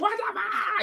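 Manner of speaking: high mocking yell with a laugh. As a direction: imitate the angry guy in the pool. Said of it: "He's in the water yelling".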